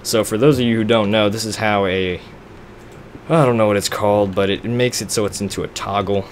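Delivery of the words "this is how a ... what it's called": usual speaking voice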